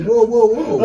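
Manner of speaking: half-laughing retold surprise; laughing alarm. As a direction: laugh through a startled whoa. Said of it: "And I'm like".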